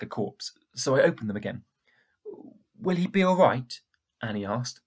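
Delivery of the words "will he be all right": worried. Acted. Anxious girl asks.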